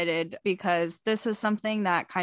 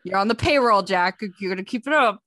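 louder, higher playful telling-off; mock scolding; 3 semitones higher, 6 dB louder than usual. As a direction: imitate an exasperated scolding. Retold scolding of bandmate on payroll.

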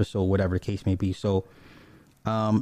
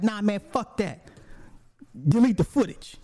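short guy's protest; 9 semitones higher than usual. Retold or imitated. Jazz telling the owner to delete the footage, high and indignant.

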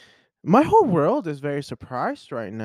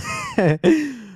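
delighted laugh. Real, not acted. Delighted discovery.

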